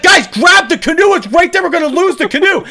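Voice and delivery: high, urgent shout; retold yelling. Shouting at family in the river to save the canoe, yelling. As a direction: yell it like the canoe is slipping away. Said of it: "I was yelling at them so much".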